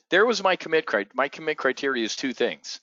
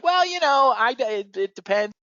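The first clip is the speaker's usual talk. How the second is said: Pelosi imitation; 10 semitones higher than usual. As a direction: dodge the question with a mock-squeaky politician voice. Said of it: "She's like".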